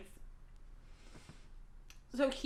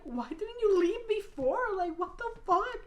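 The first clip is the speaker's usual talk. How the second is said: incredulous; 10 semitones higher, 5 dB louder than usual. Retold or imitated. People asking why she stayed.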